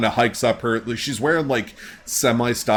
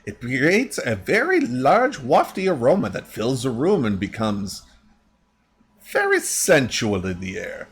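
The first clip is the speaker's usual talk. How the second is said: lightly voiced crafter, pitch swooping; chatty shop lady. Shop lady explains her recipe, lightly acted voice.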